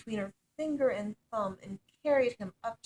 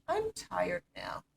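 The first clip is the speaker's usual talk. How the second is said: slow, higher tired frog voice; sleepy frog; 7 semitones higher, slower than usual. A narrator's reading. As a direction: drag the words out wearily. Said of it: "he said to the princess".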